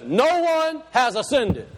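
slow, weighty "No one has ascended" with "No" stressed; slow emphasis. Shown as a demonstration. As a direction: press each word slowly. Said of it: "I'm gonna say it slow".

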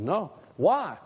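ordinary talk

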